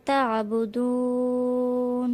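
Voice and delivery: তা'বুদুন with the long vowel held three counts; slow chant of তা'বুদুন; three-alif stretch. Shown as a demonstration. Teacher demonstrates the long vowel, slow. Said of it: "তিন আলিফ টেনে পড়তে হবে".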